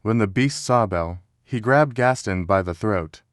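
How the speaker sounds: ordinary delivery, nothing marked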